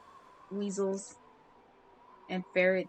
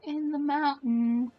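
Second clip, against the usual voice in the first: voice cracking; 7 semitones higher, 4 dB louder, slower than usual. Real, not acted. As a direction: voice cracks up high and slow. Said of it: "my voice cracked when I said that".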